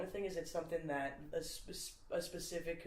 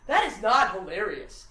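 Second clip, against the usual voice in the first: loud, emphatic comic objection; worked-up stand-up rant; Louis C K impression; 3 semitones higher, 7 dB louder than usual. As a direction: protest loudly, punching the key words. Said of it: "Louis C K is like".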